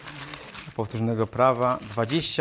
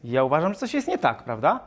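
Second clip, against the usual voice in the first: ordinary talk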